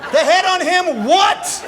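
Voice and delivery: high, incredulous demand for the rest; mock bafflement. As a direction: throw it back, high and baffled. Mock bafflement at an Irish phrase.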